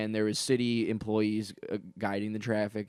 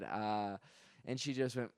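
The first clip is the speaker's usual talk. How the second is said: ordinary talk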